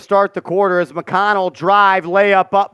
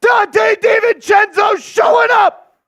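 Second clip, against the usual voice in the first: loud high slow call; excited shout; 11 semitones higher, 3 dB louder, slower than usual. Real, not acted. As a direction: shout the big shot out. Made three call.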